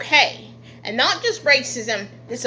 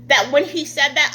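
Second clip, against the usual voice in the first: usual speaking voice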